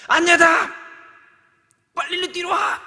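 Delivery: put-on high, loud alarmed yell to a child; father screaming at child near lion; urgent shout. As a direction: call the child away from danger. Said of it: "이렇게 하겠죠".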